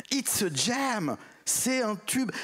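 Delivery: drawn-out, high-pitched enthusiasm, like a hit song is playing; excited exclamation. Women laughing, answering the journalist. (imitation enjouée, beaucoup plus aiguë et lente)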